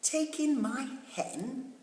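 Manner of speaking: high, slow, puzzled question; old lady baffled by the wise man's idea; surprised old lady. A narrator's reading. Old lady to wise man, high and puzzled.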